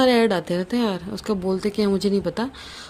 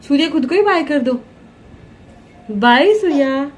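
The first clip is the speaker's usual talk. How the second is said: loud, high singsong bye; 6 semitones higher, 6 dB louder than usual. Real, not acted. Playful bye to baby.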